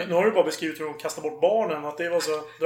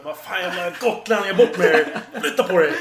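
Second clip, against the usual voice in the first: mock drunken yell; 8 dB louder than usual. Mock rowdy drunk shouting.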